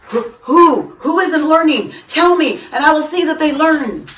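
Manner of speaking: urgently asking who is left behind; worried principal. A narrator's reading. Mister King worried.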